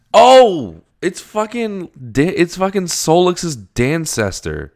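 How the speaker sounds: very high, loud, drawn-out exclaim; excited recognition. Real, not acted. To her viewers on recognizing the Dancester, a high excited exclaim.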